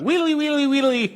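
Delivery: high-pitched sung guitar lick imitation; imitated guitar noodling. Joking with co-host about a song, high imitated guitar noise. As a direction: squeal it like a high metal guitar riff. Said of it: "conversation about the chug, chug, chug".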